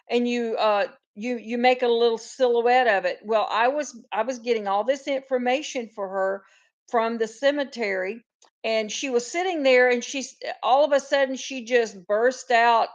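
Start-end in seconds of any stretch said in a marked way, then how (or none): none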